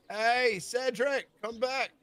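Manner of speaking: high, loud calls of Hey, Cedric, come back; calling out. Acted. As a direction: shout across the tavern. Crowd calls innkeeper back.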